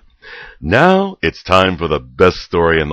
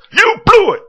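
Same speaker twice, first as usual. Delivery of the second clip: furious shout; 10 dB louder than usual. Acted. Wolf scolds narrator.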